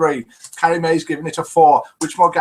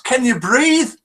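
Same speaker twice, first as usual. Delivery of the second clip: higher-pitched Scottish-sounding question; hillbilly in Scottish accent; 8 semitones higher, 4 dB louder, slower than usual. Acted. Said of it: "Then he asks".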